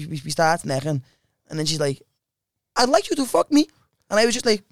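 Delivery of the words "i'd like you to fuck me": high-pitched Norwegian impression; put-on Norwegian accent, pitched up. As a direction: imitate a forward Norwegian girl. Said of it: "do a Norwegian accent".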